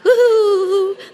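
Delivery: a hammed-up giggly chuckle; imitating a giggly laugh. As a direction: mimic the boss's cute giggle. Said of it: "his cute little giggly laugh".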